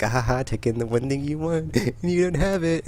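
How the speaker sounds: a light mocking sing-song tease; taunting game voice. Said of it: "the game is, like, taunting me".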